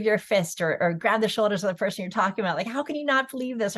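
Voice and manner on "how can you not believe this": higher-pitched mock incredulous challenge; passionate disbelief. Advocate grabbing someone by the shoulders, mock incredulous. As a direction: imitate an exasperated, passionate advocate. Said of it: "when you're very passionate about something".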